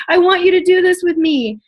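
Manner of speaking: high, loud, almost tearful plea; emotional invitation near tears; heartfelt excitement. Real, not acted. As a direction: plead with real feeling. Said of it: "I am so excited".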